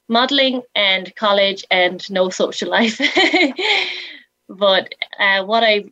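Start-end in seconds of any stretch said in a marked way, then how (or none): 3.1-4.1 brief amused laugh; a quick light laugh; short laugh. Real, not acted. Laughs at own joke.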